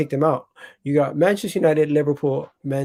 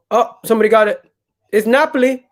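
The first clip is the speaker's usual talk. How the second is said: excited; 5 semitones higher, 5 dB louder than usual. Real, not acted. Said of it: "I don't know why I sound excited".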